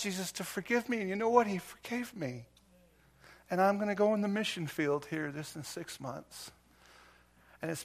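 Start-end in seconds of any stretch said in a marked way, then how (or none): none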